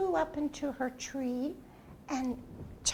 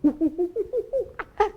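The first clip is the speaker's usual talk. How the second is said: monkey talk; 7 semitones higher, 4 dB louder than usual. Acted. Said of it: "which in monkey talk means".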